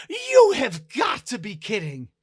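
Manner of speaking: loud, high-pitched incredulous outburst; exasperated disbelief. Acted. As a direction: cry out as if this can't be true. Dancer reacts in disbelief.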